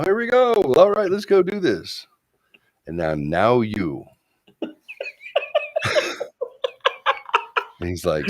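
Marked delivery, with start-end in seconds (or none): 0.0-2.1 very high, loud hype; pumped-up self talk. Retold or imitated. Psyching himself up, retold. 4.6-7.8 high-pitched wheezy cackle; high wheezing laugh. Real, not acted. Big laugh.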